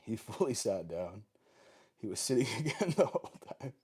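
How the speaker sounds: amused, laughing through the words. Real, not acted. Trainer watches the dog sitting again, laughing as they talk.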